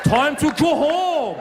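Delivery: put-on dad calling out in a thick Persian accent, slow and drawn out. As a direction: bellow the kid's name in a heavy Persian accent, stretching each word. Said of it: "with his thick Persian accent".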